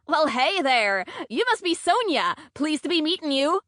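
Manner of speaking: high, bubbly put-on southern drawl. A narrator's reading. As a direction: greet with an overdone southern twang. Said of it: "I say with a southern drawl".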